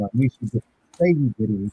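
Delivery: muffled, as if through a wall; muffled bad mic sound. Real, not acted. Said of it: "You sound muffled".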